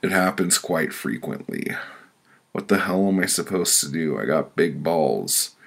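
ordinary talk, nothing marked

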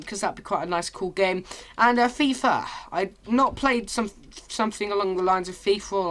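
usual speaking voice

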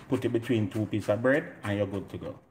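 ordinary delivery

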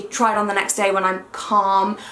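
usual speaking voice

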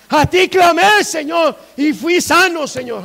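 loud, high cry of testimony easing at the end; exultant shouted psalm; psalmist crying out. Acted. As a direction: call out thanks to the Lord. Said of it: "Decía el salmista".

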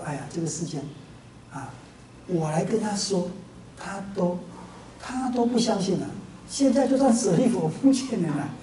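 acted-out weary resignation, low and soft; soft, low voice of someone losing hope; resigned lament. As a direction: give up on the world quietly. 阿难灰心，决定入涅槃.

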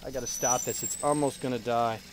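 usual speaking voice

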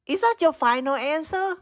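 ordinary delivery

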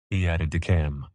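plain reading voice

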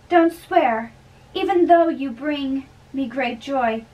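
ordinary delivery

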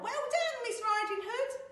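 high, loud piglet congratulation; squealed cheer to the heroine; yelled cheer. Acted. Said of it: "stood and yelled".

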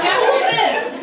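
high, loud comic disbelief; mock bewilderment. Preacher questioning the stranger on his phone, mock bewilderment. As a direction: demand it in exaggerated confusion.

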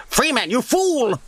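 high, strained scientist in a panic; panicked Half-Life scientist. Acted. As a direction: cry out in high-pitched alarm. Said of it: "quoting Half-Life scientist quotes".

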